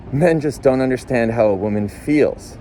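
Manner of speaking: ordinary delivery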